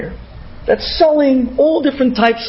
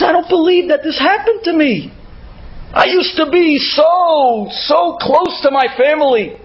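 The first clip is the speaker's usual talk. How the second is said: higher, tearful retold sobbing with a crying sound; 5 semitones higher than usual. Israeli woman tells a stranger why she weeps, crying. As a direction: voice her breaking into tears. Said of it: "She starts crying, but such a cry".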